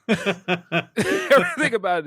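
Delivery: high, loud, laughing through the words. Real, not acted. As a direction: barely get it out for laughing. Cracking up over the scene.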